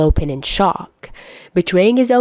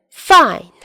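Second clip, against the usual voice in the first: higher, louder self-assured fine; confident agreement; 9 semitones higher, 5 dB louder than usual. Acted. Dazai accepting Chuya's bet, louder confident agreement. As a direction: say it like you've already won. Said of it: "Dazai agreed with confidence".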